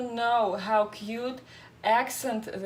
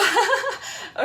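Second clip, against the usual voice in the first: high, loud burst of laughter; 11 semitones higher, 6 dB louder than usual. Real, not acted. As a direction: break into a short laugh. Laughs at her own gushing.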